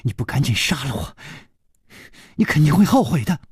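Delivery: fast, rising angry warning; seething, rushed defiance; defiant threat. Acted. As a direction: fling the threat out in a hurried girl's voice. Captive girl threatens her captor.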